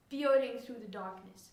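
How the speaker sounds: usual speaking voice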